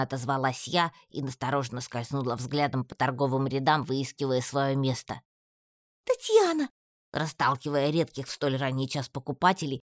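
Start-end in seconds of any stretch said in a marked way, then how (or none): 6.0-6.7 much higher voice calling out across the market; shrill call from Алька. A narrator's reading. Алька calls Татьяна across the market, loud high call. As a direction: shout her friend's name from afar. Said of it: "с громким криком кинулась ко мне".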